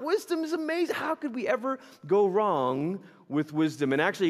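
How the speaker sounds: ordinary talk, nothing marked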